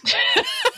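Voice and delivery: short, very high burst of laughter; sudden high laugh. Real, not acted. Reaching the punchline, a sudden high laugh. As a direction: break into a laugh.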